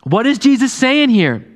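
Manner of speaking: preacher rousing the congregation; preaching at full force. Acted. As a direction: build toward hope.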